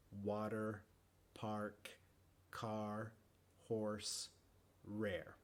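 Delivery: American "Water", "park", "rare", every R kept. Shown as a demonstration. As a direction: say each word with its R pronounced. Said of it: "en el inglés americano siempre se pronuncia".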